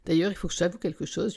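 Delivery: usual speaking voice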